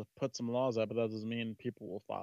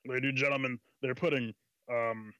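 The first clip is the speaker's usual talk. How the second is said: lightly voiced conspiracy radio host; Alex Jones. Warning ladies and gentlemen about satellites, mock conspiracy-host voice. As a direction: address the audience as a mock alarmist host. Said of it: "I'm Alex Jones".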